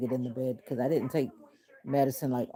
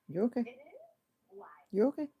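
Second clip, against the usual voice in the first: soothing pet talk; 9 semitones higher, faster than usual. Real, not acted. Soothing the startled dog.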